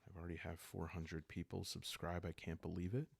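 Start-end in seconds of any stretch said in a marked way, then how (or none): none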